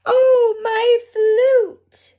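high, loud cry of dismay. Acted. Ferret losing her flute cries out in alarm. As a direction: wail as the flute slips away. Flute falls from Fifi's hands.

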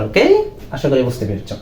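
ordinary talk, nothing marked

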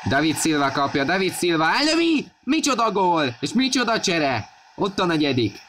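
high, ecstatic goal call; excited hungarian sports commentary. Real, not acted. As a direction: scream the goal with joy. Goal scored, commentator erupts. (eksztatikus gólöröm)

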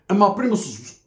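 normal talking voice

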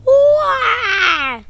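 loud, high, long "wah"; hammed-up shriek; mock scream. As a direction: wail loudly in play battle. Figure screams in battle.

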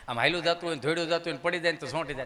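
normal talking voice

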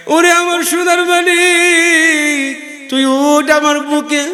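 mother's lament. Acted. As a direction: wail like a mother over her child. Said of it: "মা আসল বিষয় কাঁদবে". (সন্তানহারা মায়ের উঁচু সুরের বিলাপ)

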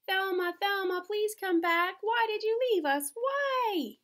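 mock-wail for the lost unicorn; wailing fans. Fans calling Thelma back, hammed-up wail. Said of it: "her fans did wail and cry".

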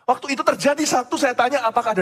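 high-pitched, forceful sermon delivery; impassioned preaching. Real, not acted. Father preaching that disaster need not shock us, impassioned. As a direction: drive each point home to the congregation, pitched high.

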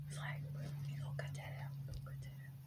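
hushed whisper aside. Real, not acted. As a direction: whisper it to yourself. Aside to self about editing.

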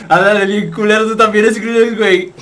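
ordinary delivery

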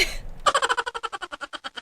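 high burst of laughter; cackling outburst at the memory. Real, not acted. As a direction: shriek with laughter.

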